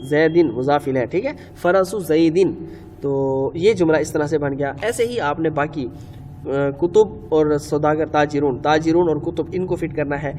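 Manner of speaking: ordinary talk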